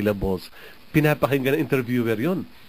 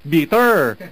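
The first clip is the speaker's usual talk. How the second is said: "bitter" in a loud, higher Bisaya accent; 4 semitones higher, 6 dB louder than usual. Shown as a demonstration. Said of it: "Yung mga Bisaya".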